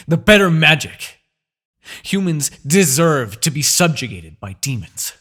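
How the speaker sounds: furious outburst. Acted. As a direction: spit out the rage at being beaten. Villain rages at unbeatable hero.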